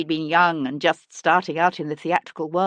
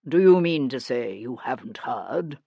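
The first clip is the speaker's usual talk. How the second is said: a touch of lower, rich stage voice; proud actor, resonant; lightly voiced theatrical gentleman; deep rich actor voice; 5 semitones lower than usual. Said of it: "The timbre of his voice was deep and rich".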